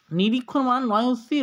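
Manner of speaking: usual speaking voice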